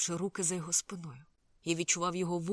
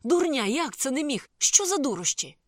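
angry outburst; 8 semitones higher, 8 dB louder than usual. A narrator's reading. Lin rejects his friend's excuse for vanishing, angry shout. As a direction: shout it in exasperated disbelief, stretching the words.

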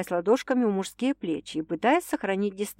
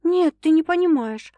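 high, louder insistent no; stubborn objection; 9 semitones higher, 5 dB louder than usual. A narrator's reading. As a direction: dig in and refuse. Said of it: "упрямо возразила Саша".

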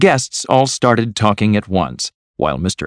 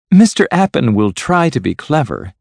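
higher-pitched, happy confidence; 6 semitones higher, 4 dB louder than usual. A narrator's reading. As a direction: say it with cheerful satisfaction. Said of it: "said Miss Resker happily".

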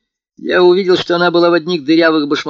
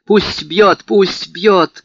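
high, loud, bitter repetition; 7 semitones higher, 5 dB louder, much slower than usual. A narrator's reading. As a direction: repeat it bitterly, with defiance. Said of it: "горько повторяла она". (горько, громко и высоко)

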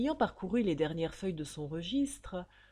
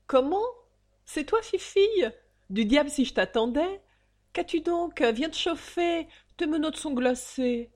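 fond surprise; 8 semitones higher, 5 dB louder than usual. Acted. Father greets daughter warmly.

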